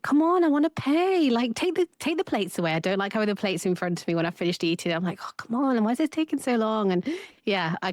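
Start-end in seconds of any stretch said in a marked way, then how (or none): 0.0-2.7 high, loud, impatient whine. Retold or imitated. Impatient self at restaurant quoted. 5.2-6.9 impatient. Retold or imitated. Impatient self quoted.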